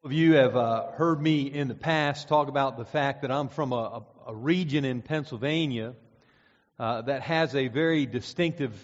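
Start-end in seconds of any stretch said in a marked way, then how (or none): none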